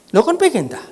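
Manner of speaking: high, loud question with a falling tail; playfully insistent query; acted teasing question. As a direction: leap up in pitch, then drop. Same teasing question acted again.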